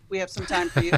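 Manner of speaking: usual speaking voice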